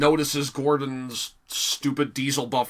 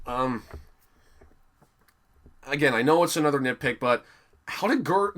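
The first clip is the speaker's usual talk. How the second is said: ordinary delivery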